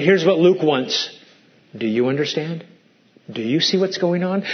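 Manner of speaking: ordinary talk, nothing marked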